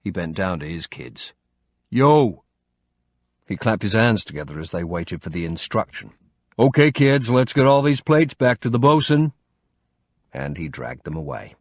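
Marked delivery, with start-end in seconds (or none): 1.9-2.4 calling out. Acted. Josh calling his kids. 6.6-9.3 put-on louder, higher call to the children; cheery dad calling kids. Josh rallying his kids, upbeat call.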